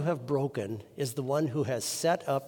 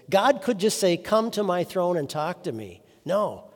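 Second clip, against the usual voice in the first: loud, raised pulpit exhortation; impassioned preaching; 4 semitones higher, 5 dB louder than usual. Real, not acted. Preacher urging congregation to approach God's throne, impassioned preaching. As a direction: urge the congregation on with force.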